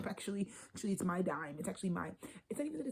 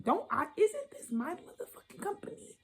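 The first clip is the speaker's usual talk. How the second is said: high indignant mock voice, pitched way up; mock outraged squeak; 11 semitones higher than usual. Reader voicing Cancer's outrage to listeners, high mock squeak. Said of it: "The whole time you're like".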